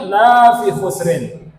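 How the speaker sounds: la fi khusrin with the la of lanya drawn out long; long la vowel. Shown as a demonstration. Teacher demonstrating the wrong long vowel, la drawn out. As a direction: stretch the la in la fi khusrin slowly. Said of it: "Ada orang baca lanya panjang".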